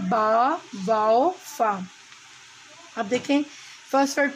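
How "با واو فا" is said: each letter name drawn out slowly; slow letter recital. Shown as a demonstration. Teacher reciting letters slowly to the class. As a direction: sound out the letters one by one, slowly. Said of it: "صوتی ترتیب کی طرف".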